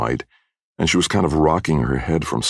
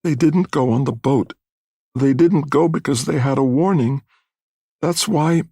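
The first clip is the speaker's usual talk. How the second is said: high, thin, weakened mother's voice; weak, thin voice; 12 semitones higher than usual. A narrator's reading. Mother on the news of the boat warning, weak and thin. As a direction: speak feebly, pitched way up high. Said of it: "in a weak, thin voice".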